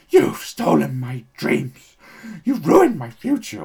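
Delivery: hammed-up screaming girl; mock screaming. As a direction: shriek it like a distraught child on stage.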